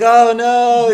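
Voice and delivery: a high, loud, hammed-up wail of "oh no"; mock crying wail. The cheating man wails to the river god in mock tears. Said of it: "he's praying and he's crying".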